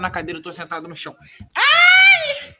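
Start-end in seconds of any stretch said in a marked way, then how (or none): 1.5-2.5 sharp high yelp of pain, much louder; sudden yelp. Real, not acted. Adjusting his seat on the floor, a sudden high yelp. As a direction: yelp as something pinches. Shifting on the floor, hurts himself.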